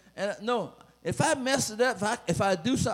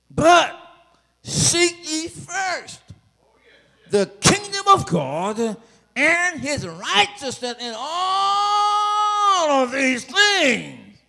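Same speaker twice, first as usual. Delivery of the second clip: much higher and louder, slow and crying out; shouted preaching climax; 11 semitones higher, 10 dB louder, much slower than usual. Acted. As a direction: cry the verse out to the rafters.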